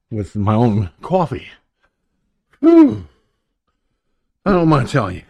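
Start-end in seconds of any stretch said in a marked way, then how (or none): 2.6-3.1 sharp whew. Real, not acted. Host blowing out after scramble.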